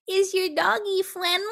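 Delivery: put-on high, sing-song baby-talk voice, cooing at the dog; sugary baby talk. Woman on the trail coos at the dog in high baby talk. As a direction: coo it like a mother talking to a toddler. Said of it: "I had to do a little bit of it".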